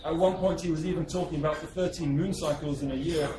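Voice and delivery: plain reading voice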